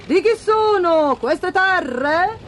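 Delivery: high, slow royal question; inquiring king. A narrator's reading. King leans out to ask the ploughman, slow high questioning. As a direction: draw the question out as a storybook king. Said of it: "Il re, sporgendosi, chiese".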